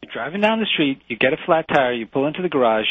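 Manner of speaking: normal talking voice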